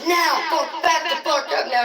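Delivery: acted angry yell; re-enacted shouting. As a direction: shout to back someone off. Re-enacts shouting her back.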